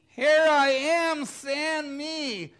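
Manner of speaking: loud, high cry of Here I am; eager answer. Pretend. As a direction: shout it like Isaiah volunteering. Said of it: "And Isaiah said".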